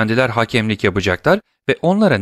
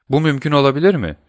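higher-pitched, quick question of mock astonishment; feigned surprise; 7 semitones higher, faster than usual. A narrator's reading. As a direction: act mildly taken aback. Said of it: "dedi şaşırmış gibi davranan Monte Cristo".

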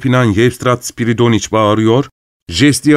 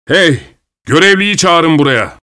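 higher-pitched, indignant summons; raised-voice order across the room; calling out; 6 semitones higher, 4 dB louder than usual. A narrator's reading. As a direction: call out sharply for the steward. Angry character calls for the steward.

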